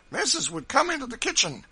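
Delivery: high, loud summons from the doorway; old servant calling in. A narrator's reading. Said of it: "put his head in at the door and wished".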